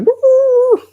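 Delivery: high, loud, drawn-out "woo"; imitated excited whoop. Retelling her reaction at the theater, excited whoop. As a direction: mimic a thrilled moviegoer's whoop. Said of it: "She was, like, freaking out".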